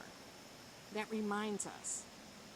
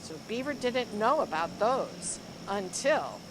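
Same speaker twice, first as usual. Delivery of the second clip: lively, expressive storybook reading; reading to children; 3 semitones higher, 6 dB louder than usual. Acted. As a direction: read it like a picture book to a class. Teacher reads picture book aloud.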